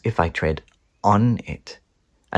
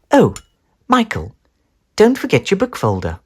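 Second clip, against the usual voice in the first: higher, bright mum calling; mum's voice; 10 semitones higher, 3 dB louder than usual. Acted. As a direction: remind him kindly. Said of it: "she says".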